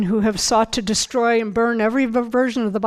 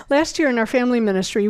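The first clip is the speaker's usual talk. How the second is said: fast restart of "Last year in our family ministry"; quick, hurried restart; galloping along; faster than usual. Shown as a demonstration. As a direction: rush the phrase to make up time. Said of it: "I'm galloping along here".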